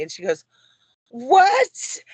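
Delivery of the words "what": very high, loud disbelieving yelp; retold astonishment. New acquaintance learns the full name matches too, astonished. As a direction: act out utter disbelief, pitch shooting up.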